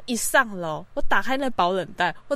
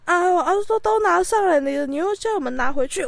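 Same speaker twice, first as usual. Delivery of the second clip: aggrieved parents; 8 semitones higher than usual. Retold or imitated. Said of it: "他们那边很委屈".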